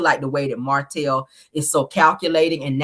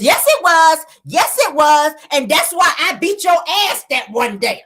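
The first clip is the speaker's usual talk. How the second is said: imitated high-pitched, loud insistence; heated retort; 10 semitones higher, 3 dB louder than usual. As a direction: fire back as the woman in the argument, hammed up. Retelling Melody's heated comeback.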